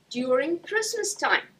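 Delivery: normal talking voice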